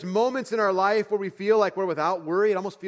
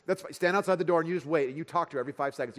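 ordinary delivery

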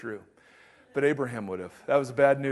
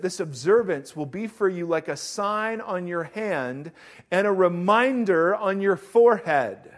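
preacher reading Exodus aloud; scripture reading; 2 semitones higher, 4 dB louder than usual. Acted.

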